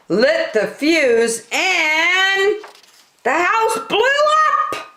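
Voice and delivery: storyteller's thrilled climb; rising excitement. A narrator's reading. Dynamite blows up house.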